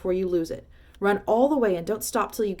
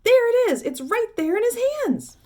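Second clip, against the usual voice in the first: high, loud, thrilled shouts; excited shouting; 10 semitones higher, 8 dB louder than usual. Acted. Shopkeeper to the crowd, shouting with wild excitement. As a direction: call everyone over in wild excitement.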